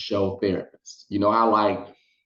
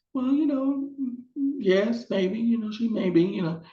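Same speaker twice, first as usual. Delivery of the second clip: high-pitched, halting mock reply; hesitant hedging; 13 semitones higher than usual. Guest answering whether mother is jealous, hesitant hedging. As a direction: hedge awkwardly in a much higher voice.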